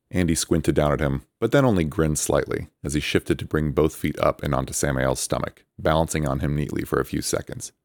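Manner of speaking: ordinary delivery, nothing marked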